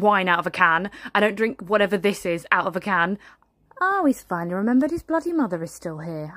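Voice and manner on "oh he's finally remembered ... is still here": ordinary talk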